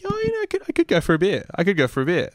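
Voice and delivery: coy self-musing. Retold or imitated. Quotes himself coyly musing about beer.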